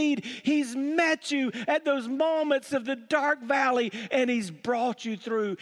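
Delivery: preaching at full force. Acted. Pastor calling the congregation back to the table, full preaching force. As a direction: build the call to the wandering believer.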